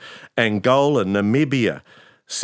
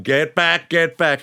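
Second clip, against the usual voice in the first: mock furious order; 6 semitones higher, 3 dB louder, slower than usual. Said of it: "He was furious".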